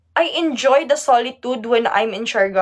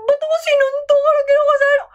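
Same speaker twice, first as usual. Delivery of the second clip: much higher, shrill-free outraged question; indignant protest. Retold or imitated. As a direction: demand why he hit you, outraged. Protesting dad's punch.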